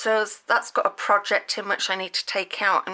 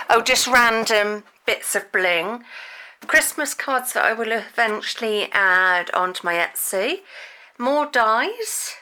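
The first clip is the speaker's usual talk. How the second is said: usual speaking voice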